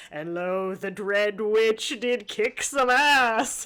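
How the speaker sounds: slow, swelling grand declaration; mock bardic proclamation. Narrating the dread witch's victory, mock-heroic. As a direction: proclaim it like an epic, building loud. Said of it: "And he's just like".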